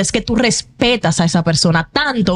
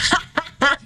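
risa aguda; 8 semitones higher, 5 dB quieter than usual. Real, not acted. Risa tras "yo sabía.

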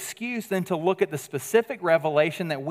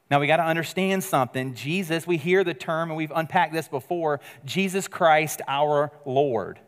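usual speaking voice

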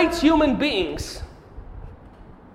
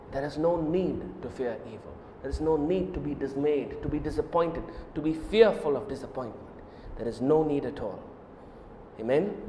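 much lower and softer, fading; gentle reassurance; 8 semitones lower, 6 dB quieter than usual. Acted. Preacher calming the congregation's fear, very softly. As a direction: soothe them down to a hush. Fades into soft comfort.

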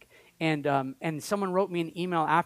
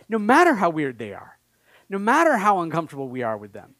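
loud, rising plea for more gifts; fervent preaching; 4 semitones higher, 5 dB louder than usual. Acted. Pastor urging his church to hunger for gifts, loud fervent preaching. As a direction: preach it out hard to the congregation.

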